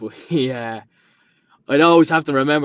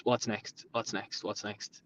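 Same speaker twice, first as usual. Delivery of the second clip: each what's next softer than the last, drifting off; restless repeating fading out; 4 semitones lower, 14 dB quieter, slower than usual. Retold or imitated. Restless urge for the next job.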